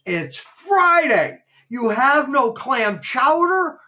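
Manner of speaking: high, loud, slow outraged shout; retold angry yell. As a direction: bellow it like an indignant regular denied his soup. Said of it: "screaming at him".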